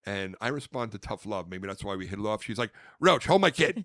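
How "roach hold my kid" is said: loud, high brusque command; abrasive order. Retold or imitated. Big-sister mentor handing over her kid, abrasive loud order. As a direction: order him about like a tough big sister. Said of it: "but she speaks abrasively".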